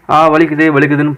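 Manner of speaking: normal talking voice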